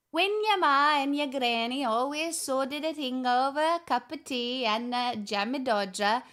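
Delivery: put-on Scottish reading voice; Scottish accent. Shown as a demonstration. Streamer reading from the wee book, Scottish accent. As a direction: read it aloud as a would-be Scot. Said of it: "would you like my Scottish accent?".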